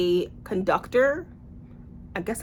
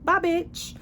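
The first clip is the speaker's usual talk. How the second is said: much higher, mock sassy "bye"; dismissive brush-off; 9 semitones higher than usual. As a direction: flick her off with a high sassy goodbye. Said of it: "he is just looking at her like".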